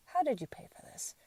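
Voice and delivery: imitating her husband's calm quiet question. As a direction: ask it softly and evenly. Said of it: "In a very s-, calm, quiet voice".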